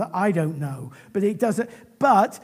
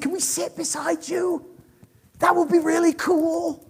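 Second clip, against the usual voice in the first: higher-pitched, passionate eager plea; eager request; 7 semitones higher than usual. Retold or imitated. Disciples asking Jesus to sit beside him, eager. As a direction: ask like an excitable, devoted friend. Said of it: "that was the passionate, loving".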